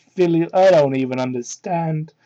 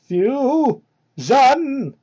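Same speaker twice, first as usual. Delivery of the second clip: mock fusion call; 7 semitones higher, 3 dB louder than usual. Mock-dramatic fusion chant.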